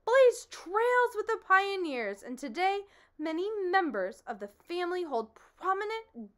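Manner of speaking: high, grandiose bragging voice; boastful humbug. Acted. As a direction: puff up and brag about the noble family line. Humbug boasts of his family.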